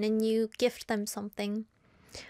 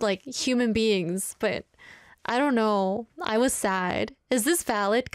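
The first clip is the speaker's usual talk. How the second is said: ordinary talk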